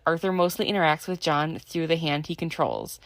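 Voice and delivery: ordinary delivery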